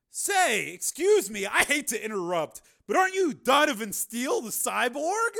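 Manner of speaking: a nosy fan barging in; pushy fan. Acted. Bar patron interrupting Steele, pushy.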